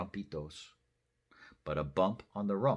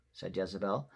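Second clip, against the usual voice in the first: ordinary delivery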